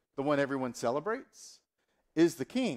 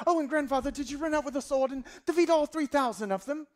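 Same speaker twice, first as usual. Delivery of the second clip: very high, louder, excitable grandchild; accented grandson; 12 semitones higher, 4 dB louder than usual. Acted. Grandson interrupting grandfather's battle story, high excited put-on accent. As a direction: gush in a piping put-on accent. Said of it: "I don't know why they have that accent".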